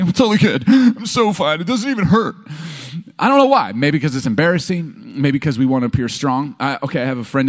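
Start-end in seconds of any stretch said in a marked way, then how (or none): 0.0-2.3 higher, breezy denial; mock brave brush-off; fake fine. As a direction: pretend nothing hurts. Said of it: "I'm like".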